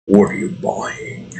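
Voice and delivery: half-voiced shopkeeper's sales line; Resident Evil merchant. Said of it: "the merchant from Resident Evil".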